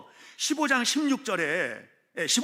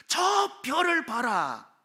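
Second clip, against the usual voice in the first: very slow and raised, 저 stretched long; drawn-out divine command; 6 semitones higher, much slower than usual. Acted. God telling Abraham to look at the stars, drawn-out command. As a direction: stretch out 저, pointing far up to the stars.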